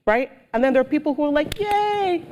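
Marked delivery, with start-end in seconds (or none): 1.6-2.2 mock cheer. Cheering supporters imitated.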